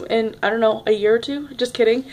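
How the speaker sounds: ordinary talk, nothing marked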